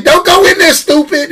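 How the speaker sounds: high-pitched heckle at the screen; moviegoer's mock warning. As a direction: imitate an exasperated viewer warning a character.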